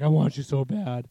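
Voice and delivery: loud, hammed-up pleading as an obsessed pet; creepy needy cat. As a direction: play a cat confessing a creepy crush. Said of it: "it'd actually be creepy".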